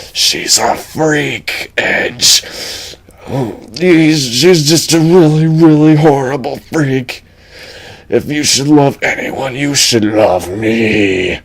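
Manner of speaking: slow creepy dragon purr. Acted. The dragon wooing Edge, slow creepy voice. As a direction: coax him like a sly beast.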